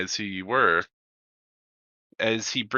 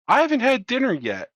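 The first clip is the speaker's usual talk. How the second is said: calling out; 13 semitones higher, 5 dB louder, faster than usual. Acted. Distant prisoner calls out.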